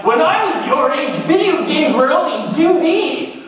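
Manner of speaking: hammed-up geezer scolding the youth; grumpy old guy. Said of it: "acting like an old guy where it's just like".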